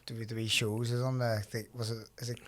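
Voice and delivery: ordinary talk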